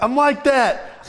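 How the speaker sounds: high, drawn-out retold boast; flexing. Teammate retells pitcher flexing on his rival, boastful. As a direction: stretch it out like a cocky taunt. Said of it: "he's just flexing on him".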